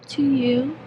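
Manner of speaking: normal talking voice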